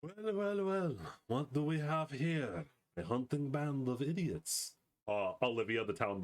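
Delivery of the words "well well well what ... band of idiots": slow, drawling scornful entrance; mocking newcomer. Town merchant greets the hapless hunting band, slow mockery. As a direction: draw out each word with mock surprise.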